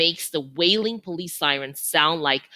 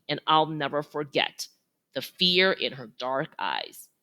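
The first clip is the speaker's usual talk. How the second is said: plain reading voice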